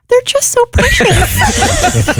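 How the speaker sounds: hammed-up tearful squeak; very high, slow, overcome-with-cuteness wail; about to cry. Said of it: "Are you about to cry?".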